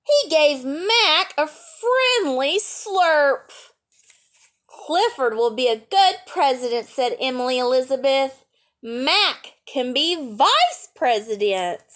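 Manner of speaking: bright read-aloud storytelling voice; lively picture-book reading; story narration. A narrator's reading.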